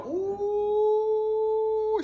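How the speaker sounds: high ooh. Retold or imitated. Exasperated at the little girl.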